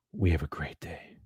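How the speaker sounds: slow breathy whisper; hushed, drawn-out whisper. Acted. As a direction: whisper it slowly like a secret. Repeated phrase whispered.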